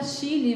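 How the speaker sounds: Chile said "shi-li", with a sh sound and a final i. Shown as a demonstration. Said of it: "pessoa que não tem condição mínima".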